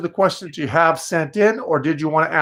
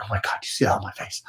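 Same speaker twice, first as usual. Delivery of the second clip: quick, hushed, lower mock horror; mock mortified; 8 semitones lower, 8 dB quieter, faster than usual. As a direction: play the embarrassed self, quick and hushed. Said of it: "I'd be like".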